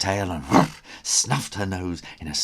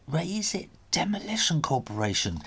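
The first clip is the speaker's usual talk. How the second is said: whispered, squinting to read; reading a sign; 7 semitones higher, 4 dB quieter than usual. A narrator's reading. Connor squints to read the machine, whispering. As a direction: spell out the sign under his breath. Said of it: "he read out loud".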